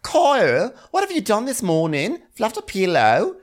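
put-on voice mocking a lazy coworker; scolding Natalia. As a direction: needle him with mocking scorn. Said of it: "she's like".

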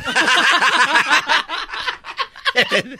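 carcajada aguda y larga. Real, not acted. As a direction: suelta una risa fuerte, sin contenerse. Se ríe de la burla. (carcajada aguda)

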